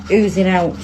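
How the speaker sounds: normal talking voice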